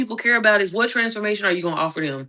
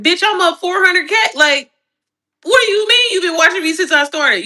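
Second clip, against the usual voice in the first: loud clapback; 10 semitones higher, 5 dB louder than usual. Real, not acted. Clapping back at old viewers.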